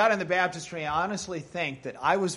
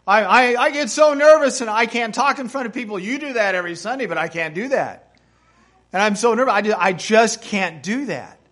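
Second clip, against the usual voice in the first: insistent protest, louder at the start; determined refusal; 3 semitones higher, 8 dB louder than usual. Retold or imitated. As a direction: retell a flustered, adamant refusal. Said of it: "with such great determination".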